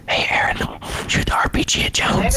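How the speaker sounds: whispered urging into an ear. Retold or imitated. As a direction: whisper it like a sneaky mom. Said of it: "whisper in your".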